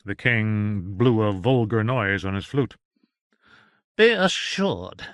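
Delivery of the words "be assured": slow, pointed warning; menacing assurance. Acted. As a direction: lean on each word. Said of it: "said Doctor John".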